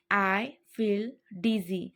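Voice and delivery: slow, careful repeat for learners; unhurried teaching repeat. Shown as a demonstration. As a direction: spell it out slowly. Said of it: "teacher repeats phrase twice".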